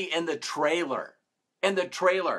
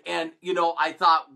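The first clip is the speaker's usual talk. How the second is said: usual speaking voice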